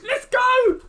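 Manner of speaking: big high shout of joy; real overjoyed yell; excited cheer. Real, not acted. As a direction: cheer it out, thrilled. Cheering brother's uni offer.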